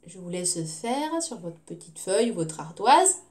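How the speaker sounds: ordinary talk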